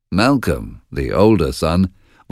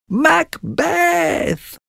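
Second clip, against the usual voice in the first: very high witch shriek; witches crying out. Acted. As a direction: cry the name out like hags. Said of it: "they cried together".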